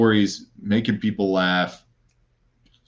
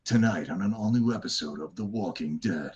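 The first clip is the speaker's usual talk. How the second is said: deep bad-guy promo announcer; super deep voice; 6 dB quieter than usual. Shown as a demonstration. Guest imitates the guy promoting AMC shows, super deep bad-guy voice. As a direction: read it like a dark TV teaser. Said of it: "Just, like, super deep".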